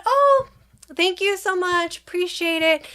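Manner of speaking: high, sing-song polite gratitude; effusive polite thanks. Retold or imitated. Mother thanking the helper who finally came out, effusively polite. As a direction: lift it high for overly courteous thanks. Acted thanks to the person removing it.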